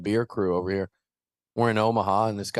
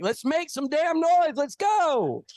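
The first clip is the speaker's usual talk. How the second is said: much higher, squealing hype yell; hype man voice; slower than usual. Retold or imitated. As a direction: do an over-the-top hype man. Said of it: "I was with his voice".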